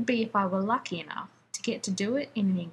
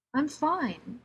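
pitched a little too high, thin; a tight, strained "fine"; 6 semitones higher than usual. A narrator's reading. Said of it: "Rodney said thinly, his voice a little too high".

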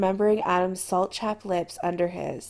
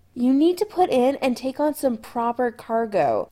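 firm and slightly raised; firmly; 4 semitones higher, 4 dB louder than usual. A narrator's reading. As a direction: lay down the law to the captain. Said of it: "Chris said firmly".

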